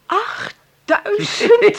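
slow, high gasping disbelief; gasping shock. Acted. Hilde gasping at the size of the inheritance. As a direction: gasp and stretch the word out. Gasps at the sum.

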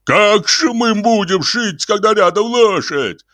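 high, drawn-out hysterical protest; frantic high-pitched bawling; wailing outcry. A narrator's reading. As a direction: wail in complaint, pitch way up. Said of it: "завопил Ляпис".

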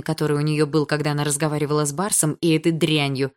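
ordinary delivery, nothing marked

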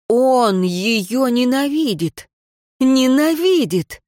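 slow, rising anguish; anguished despair. A narrator's reading. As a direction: break down as it sinks in. Irein's despair.

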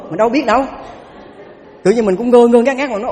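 ordinary talk, nothing marked

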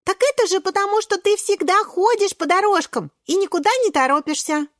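put-on high, breathless-with-excitement child character; eager little Шпинатик. Шпинатик telling friends about garden adventures, high and excited.

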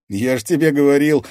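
high, loud amused told-you-so; chuckling triumph. A narrator's reading. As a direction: crow it at your friend. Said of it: "хохотнул Артём".

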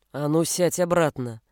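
low, menacing fatherly command; growled order. A narrator's reading. Father ordering son back to table, growling. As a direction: growl it low without yelling. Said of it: "зарычал Бруно".

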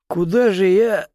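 high, slow, moaning plea. A narrator's reading. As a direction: whimper it out, moaning. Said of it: "промычал дядя Боря".

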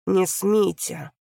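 slow hissed threat; father stops the interrogation, slow and hissed; hissed warning. Acted. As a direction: press each word out slowly, like a hiss. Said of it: "прошипел Рогов".

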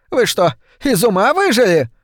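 put-on shrill old woman. A narrator's reading. Old woman shrieking at Seth.

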